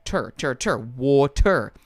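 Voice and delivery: "tur" repeated with a hard R, then slow "water"; strong R ending. Shown as a demonstration. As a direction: split "water" into "waw-tur", R sounded. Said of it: "R because of the American influence".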